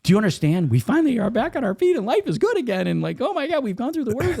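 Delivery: very high, loud pleading protest; retold exasperated wife. Wife protesting they only just recovered, high-pitched exasperation. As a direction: imitate a spouse pleading in alarm.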